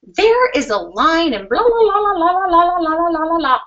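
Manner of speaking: high, loud mocking blah blah chatter; hammed-up impression of an angry complainer; mocking griping rant. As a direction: mimic someone nagging on and on. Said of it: "proceeds to start griping me out".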